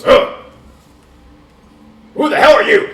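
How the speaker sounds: loud, high furious bark at strangers; angry shout. Acted. Council leader yelling at strangers who barged in, angry. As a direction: yell at the intruders. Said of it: "He looks up and goes".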